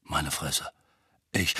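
whispered, low and stunned; drained, barely voiced mutter; faint. A narrator's reading. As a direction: let it out weakly under the breath. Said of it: "sagte Mandanges matt".

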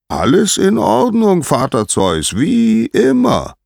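very high, loud singsong answer; high, ringing herald's voice; Helios calling down. A narrator's reading. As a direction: call it down from the sky, stretching the last words.

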